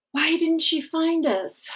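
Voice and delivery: put-on high, small questioning child voice; a boy lost and confused; child asking.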